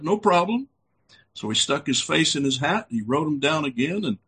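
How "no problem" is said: higher, carefree mock reassurance; breezy Joe Smith. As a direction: toss it off like it's nothing. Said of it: "He said".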